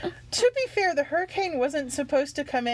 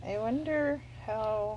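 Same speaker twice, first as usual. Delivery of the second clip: creepy voice; 6 dB quieter, slower than usual. Real, not acted. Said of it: "It is a kind of a creepy voice".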